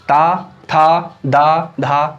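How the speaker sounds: ता, था drawn out very slowly; teacher voicing ता था दा धा ना very slowly; slow syllable demo. Shown as a demonstration. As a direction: sound out the dental syllables one by one, slowly. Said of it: "तो आप उच्चारण करके देखें".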